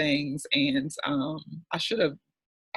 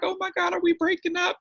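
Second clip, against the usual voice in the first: squeaky, much higher mock panic; a hammed-up gasp of dismay, pitched way up; mock alarm. To her therapist, joking about a breakup, in high mock alarm.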